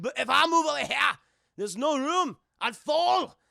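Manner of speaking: loud, high Scottish bluster; hammed-up indignant Scot; protesting Scotsman. Scotsman refusing to move over for Jack, indignant.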